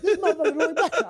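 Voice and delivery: high, rapid mock heckling; onlookers at windows. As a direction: imitate a scandalised neighbour calling out, half laughing. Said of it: "people were, like, out the window".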